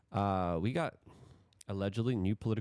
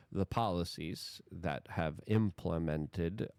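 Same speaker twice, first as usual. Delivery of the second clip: usual speaking voice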